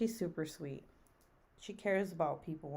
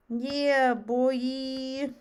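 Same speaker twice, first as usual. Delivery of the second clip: high, loud mock "Yeah, boy" again; imitating Jonathan; 6 semitones higher, 7 dB louder than usual. Streamer repeats friend Jonathan's hype call, loud and high. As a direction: belt it out like Jonathan's catchphrase. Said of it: "He goes".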